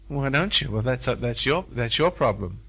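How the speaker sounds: ordinary talk, nothing marked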